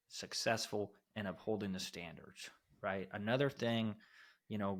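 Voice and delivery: normal talking voice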